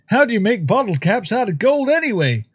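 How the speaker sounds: a hint of a Canadian reporter asking; mild mock Canadian accent. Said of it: "best Canadian accent I could do".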